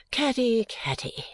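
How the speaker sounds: weary reproach, dropping away. A narrator's reading. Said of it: "quite weary of such little matters".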